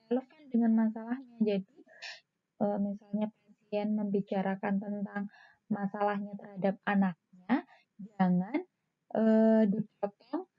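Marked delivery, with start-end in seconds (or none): none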